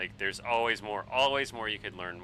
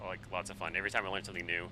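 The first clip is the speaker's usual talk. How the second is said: ordinary delivery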